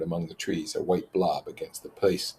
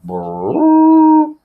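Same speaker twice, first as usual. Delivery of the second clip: loud high buzzing brrr; conch blast imitation; 13 dB louder than usual. Narrator imitating Jack blowing the conch, loud buzz. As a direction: blow it out like a horn.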